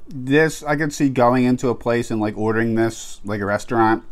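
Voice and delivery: talking between bites of pizza; chewing while talking. Real, not acted. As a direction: mouth partly full of food. Seen 0: Bit pizza earlier, cheeks working, pizza held near mouth throughout.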